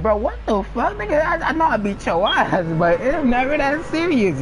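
incredulous retort. Retold or imitated. Narrator to screaming cousin, mock disbelief. Telling beaten cousin to calm down.

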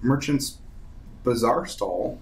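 ordinary delivery

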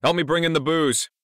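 loud, high call for help; proclaiming. A narrator's reading. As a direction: holler it to the next room. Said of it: "proclaimed from the kitchen".

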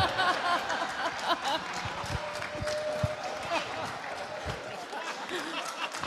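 long amused burst of laughter; real laugh. Real, not acted. Speaker cracking up at an audience member's joke, real laughter. Audience jokes about cuddling ex-wives.

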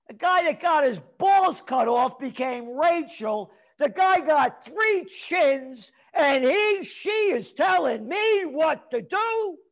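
scornful shouted tirade; angry rant. Real, not acted. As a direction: hammer each word in contempt. Ranting about a health official.

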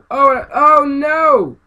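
drawn-out high loud wail of alarm; real alarmed cry. Real, not acted. Gamer watching his run fail, slow loud wail. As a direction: cry out as the buggy fails.

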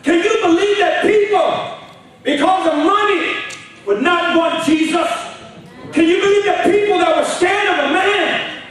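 loud, high fired-up sermon; preaching at full force. Acted. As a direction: preach it out to the whole room. Preaching to congregation.

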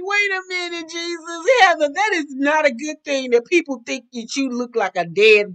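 high, squealed incredulous protest; amused disbelief. Retold or imitated. Recapper reacting to Heather's lookalike claim, high amused disbelief. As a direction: protest in a high voice, nearly cracking up.

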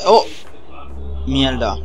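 usual speaking voice

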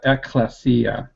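"ecclesia" slowly and carefully sounded out; pronunciation demo. Shown as a demonstration. Helping co-host with the Greek, careful pronunciation demo. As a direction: say "ecclesia" with short vowels, accent on the final iota. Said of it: "I would pronounce that".

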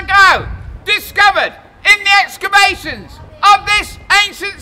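loud slow proclamation; town crier. Acted. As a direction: announce it grandly to the crowd.

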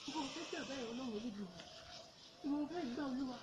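barely audible, low anxious pleading; frightened muttering. Acted. Evangelist asking God where to flee, frightened muttering. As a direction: ask God for a way out, very softly. Asks God which way to escape.

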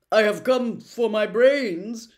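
loud, slow, hesitant straw-man voice; uneasy Scarecrow. Acted. Scarecrow asking the wizard for his brains, slow and uneasy. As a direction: ask for it unsure of yourself.

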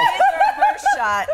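laughing. Real, not acted. Laughing demand for camera shot.

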